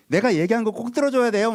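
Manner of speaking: 높은 목소리로 아빠에게 떼쓰기; 아빠에게 꼭 들어달라 조르는 아이 흉내. Retold or imitated. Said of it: "막 이러면서 얘기하잖아요". (높게 떼쓰는 아이 말투)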